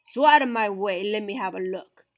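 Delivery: loud, pushy bully dog; loud bossy mean dog. Acted. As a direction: shove them aside with your voice. Big Bark bosses crowd.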